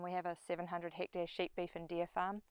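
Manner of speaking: ordinary talk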